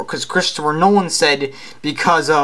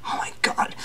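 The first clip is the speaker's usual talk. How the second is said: whispering; 9 dB quieter than usual. Acted. Exasperated aside about Hulk.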